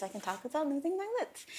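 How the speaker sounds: ventriloquist voice, lips held still; talking with mouth closed, lips not moving. Shown as a demonstration. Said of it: "like this".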